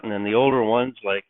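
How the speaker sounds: normal talking voice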